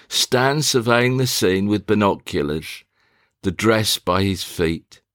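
normal talking voice

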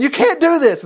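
high-pitched pleading objection; mock-urgent protest; acted protest. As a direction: protest it as the alarmed brother. Reuben protesting to his brothers.

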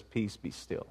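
a hint of Jesus, low and hushed; calm Jesus. Preacher voicing Jesus calming the storm, hushed and low. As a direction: say it softly, stilling the storm. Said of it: "gets up and says".

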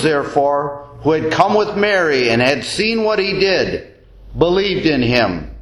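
ordinary talk, nothing marked

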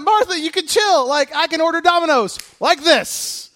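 high, loud, breezy; casual Jesus. Retold or imitated. Jesus telling busy Martha to relax, breezy and loud. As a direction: toss it off easily. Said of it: "he's going".

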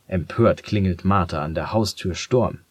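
ordinary delivery

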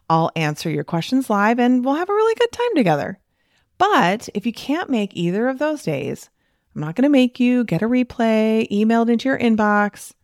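friendly podcast promo voice; upbeat promo read. Acted. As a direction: sell the event like an eager host inviting a friend. Promo read for her presentation.

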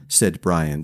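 usual speaking voice

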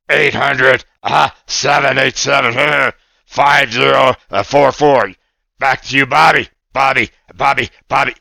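giggly puppet reading a number; lightly voiced excited puppet boy; a hint of a cartoon puppet, half laughing; Oscar puppet voice. Puppet Oscar reads phone number.

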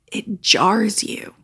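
slow, breathless whisper; hushed whisper. Real, not acted. Talking about the picture of the killing, a slow shaken whisper. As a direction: let it drop to a shaken whisper.

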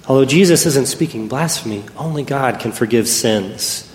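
ordinary talk, nothing marked

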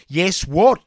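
loud barked demand. Acted. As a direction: bark it like a drill sergeant. Trainer demanding sir.

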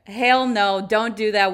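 retold yell, loud and emphatic; mock enthusiastic yelling. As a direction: bark it like an over-eager parking-lot scold. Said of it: "like, yell at me, like, super enthusiastically".

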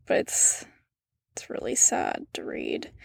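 real, sad near-whisper; breathy, saddened hush. Real, not acted. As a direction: drop to a whisper, too upset to go on. Upset reading abuse transcript.

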